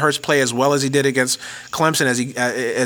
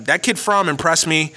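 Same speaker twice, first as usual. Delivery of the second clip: ordinary talk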